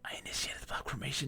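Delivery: hushed whisper to chat. Real, not acted. Streamer whispering to chat mid-game, hushed. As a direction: breathe it out, barely voiced.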